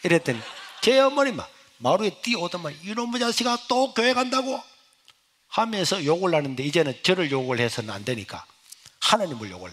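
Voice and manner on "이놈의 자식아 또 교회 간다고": higher indignant scolding; angry scolding. Retold or imitated. Mother hearing he goes to church again, high angry scolding. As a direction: scold him in disbelief.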